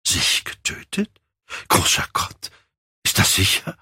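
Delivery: hushed, voiceless shock; horrified. A narrator's reading. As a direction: gasp the questions out in horror, without shouting. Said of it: "schrie Hallward auf und blickte ihn entsetzt an".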